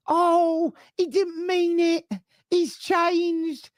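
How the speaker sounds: high, whiny mock pleading; Little Mo. Geordie fan excusing a player, high-pitched wheedling. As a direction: make excuses in a squeaky, drawn-out voice. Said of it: "It sounds like Little Mo from EastEnders".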